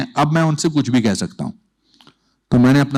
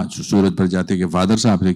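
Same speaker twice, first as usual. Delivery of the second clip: normal talking voice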